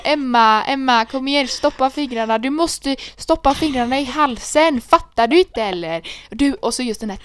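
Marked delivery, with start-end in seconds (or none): 0.0-6.1 mock nagging. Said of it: "och jag bara".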